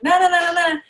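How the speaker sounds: quick high-pitched refusal; mock brush-off. Imagined brush-off of talkers.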